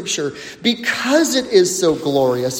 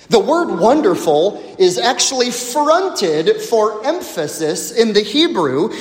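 ordinary talk, nothing marked

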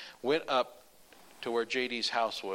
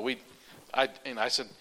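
normal talking voice

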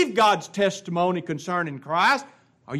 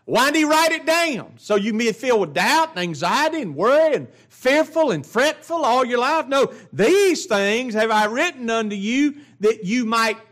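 preaching at full force; 6 semitones higher than usual. Acted. Preacher urging the congregation not to live in doubt, at full force. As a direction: drive the point home to the pews.

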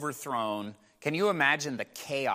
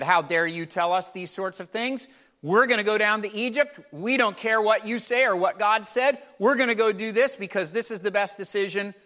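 mildly raised, defiant retort; angry defiance; 6 semitones higher, 3 dB louder than usual. Acted. Survivors snapping back at Jeremiah, mild mock anger. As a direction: push back at the prophet, a touch heated. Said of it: "they got mad at Jeremiah".

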